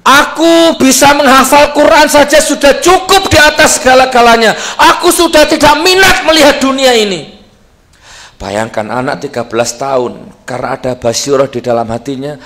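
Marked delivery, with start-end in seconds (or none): 0.1-7.3 put-on much higher, louder boyish voice; high, loud child's voice declaring his faith; boy's voice. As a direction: speak as the boy, firm and proud. Preacher voices blind boy.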